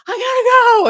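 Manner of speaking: high, loud panicked squeak; urgent squeal. Retold or imitated. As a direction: squeal it like you suddenly have to rush. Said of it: "Then I'm like".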